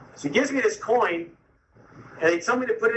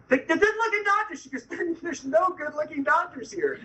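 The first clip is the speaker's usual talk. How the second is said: higher, amused wife; wife's voice; 9 semitones higher than usual. Retold or imitated. Wife telling husband there's no such doctor, amused. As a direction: put on a light, laughing wife voice. Voicing wife Becca's replies.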